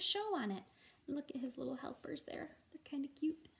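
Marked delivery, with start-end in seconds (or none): none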